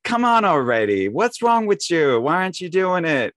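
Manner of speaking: fed up with someone not pulling weight, mock exasperation; exasperated mock complaint. Exasperated voice of irritation.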